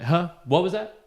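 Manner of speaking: high, loud confrontational "Huh?"; in-your-face stranger. Retold or imitated. Imitating the scruffy man bothering people on the path, pushy challenge. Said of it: "getting up into someone's face and be like".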